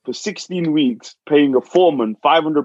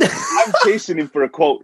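half-shouted through a laugh, higher; laughing outburst; 8 semitones higher than usual. Real, not acted. Host laughing while telling the builder story, half-shouting. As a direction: blurt it out laughing in disbelief.

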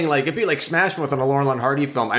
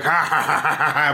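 mock wolf laugh; 3 semitones higher than usual. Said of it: "he'd be like".

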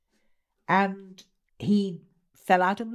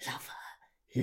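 hushed, intense whisper; 6 semitones lower, 11 dB quieter than usual. Acted. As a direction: breathe it like a secret obsession. Whispered repetition of command.

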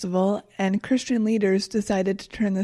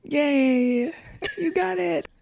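high-pitched little teasing cheer; mock congratulation; 7 semitones higher, much slower than usual. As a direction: cheer them sweetly, as if for a small child. Teasing cheer at obvious realisation.

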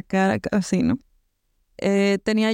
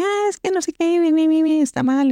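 imitating the old cutter yelling, much higher and louder; mock yell; 8 semitones higher, 3 dB louder than usual. Mocking the old cutter who yelled at her, high mock yell. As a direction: whine it up high like a cranky old man shouting. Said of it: "el señor así gritándome".

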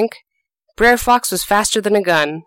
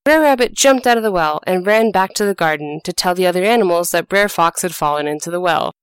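usual speaking voice

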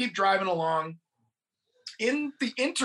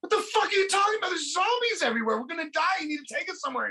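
high, fast mock shrieking protest; high-pitched panicky pleading; imitated panicked outburst; 9 semitones higher, much faster than usual. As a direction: burst out in hammed-up, frantic disbelief. Retold panicked reply about zombies.

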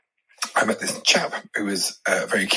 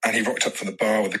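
normal talking voice

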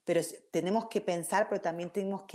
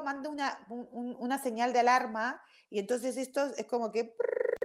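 ordinary talk

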